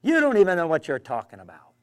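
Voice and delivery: loud heckling retort; a mock shout from the crowd; retold shout. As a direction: throw it back like a heckler in the crowd. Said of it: "he shouted".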